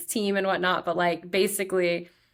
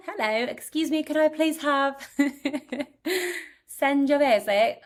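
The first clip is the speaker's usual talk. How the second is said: mock tourist ordering; 7 semitones higher than usual. Acting out ordering at wine window.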